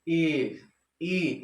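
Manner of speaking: ई as a held vowel, mouth almost shut; nearly closed-mouth ई. Shown as a demonstration. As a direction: say ई through barely parted lips. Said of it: "मेरा मुंह थोड़ा सा बस खुला है". (लगभग बंद मुंह से ई का उच्चारण)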